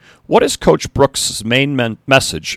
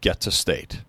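dropped low and soft for weight; hushed emphasis; 4 semitones lower, 7 dB quieter than usual. A narrator's reading. Coach pointing at the board, soft and lower. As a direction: lower and softer, pointing to the goal. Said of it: "and he pointed at the board".

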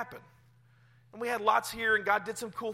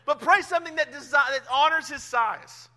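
laughing urge; 7 semitones higher, 10 dB louder than usual. Real, not acted. Laughing aside, then urging.

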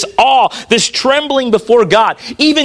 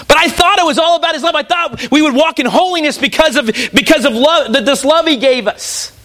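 high, rushed protest; mock objection; 5 semitones higher than usual. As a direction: imitate a bewildered churchgoer objecting. Voices an objecting listener.

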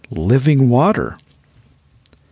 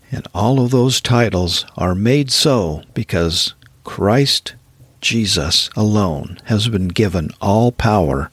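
ordinary talk, nothing marked

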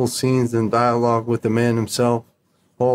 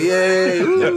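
mock cheering crowd; 6 semitones higher than usual. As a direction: cheer like a whole crew applauding. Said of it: "Everybody comes out clapping".

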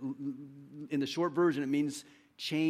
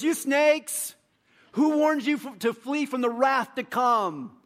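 much higher, louder, slow at the end; mock prophet shout; 9 semitones higher, 7 dB louder, slower than usual. Preacher acting John rebuking the crowd, mock prophet shout. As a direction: blast the insult at the crowd. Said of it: "He goes".

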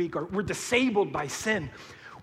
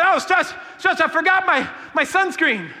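put-on higher, loud kid calling for help; whiny kid; 9 semitones higher, 6 dB louder than usual. Kid tells pastor it forgot sunscreen, higher whine. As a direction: beg like a kid who forgot something.

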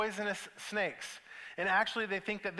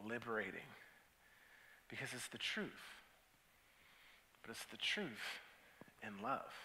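hushed reflective preaching; 5 semitones lower, 8 dB quieter than usual. Acted. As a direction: lay it down gently, almost confessional.

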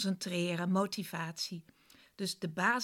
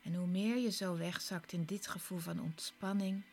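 guided meditation. Acted. Leads a guided relaxation.

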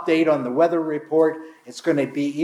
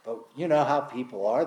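ordinary talk

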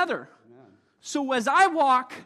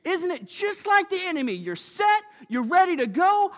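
ordinary talk

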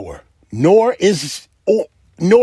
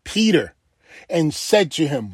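normal talking voice

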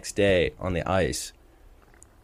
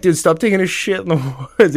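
laughing scold; 8 semitones higher, 4 dB louder, faster than usual. Retold or imitated. Retold scolding, breaking into laughter.

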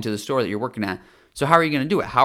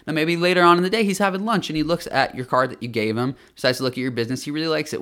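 usual speaking voice

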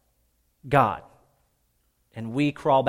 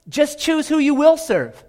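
higher, louder and slow, each word driven home; forceful challenge; 11 semitones higher, 6 dB louder than usual. Acted. Joshua challenging the people, slow and forceful. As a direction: hammer the choice at the crowd.

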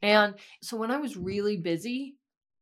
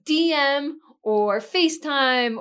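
excited; 7 semitones higher, 7 dB louder, slower than usual. Real, not acted. Excited pitch.

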